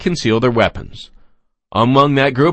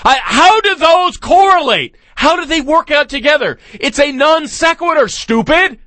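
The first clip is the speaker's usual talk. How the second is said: incredulous rant; 12 semitones higher than usual. Real, not acted. Host ranting at illogical quote.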